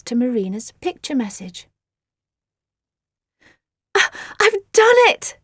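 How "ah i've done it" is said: high, loud excited gasp. Acted. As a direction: burst out in triumph. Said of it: "she gasped".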